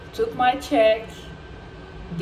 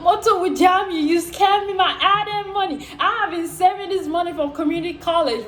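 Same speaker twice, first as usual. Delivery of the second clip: high-pitched indignant rant; hammed-up outrage at being scammed; retold cursing; 6 semitones higher than usual. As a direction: curse the thief, half laughing. Cursing scammer, indignant over lost savings.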